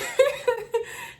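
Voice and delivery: high-pitched burst of giggling; giggle. Real, not acted. Laughs during her story.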